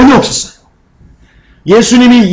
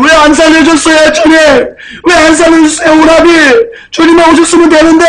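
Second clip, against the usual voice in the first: tearful outburst; 7 semitones higher than usual. Acted. Mary crying to the Lord over her brother, aggrieved wailing. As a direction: wail the reproach like a mock sobbing plea. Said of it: "하면서 막 울었던 겁니다".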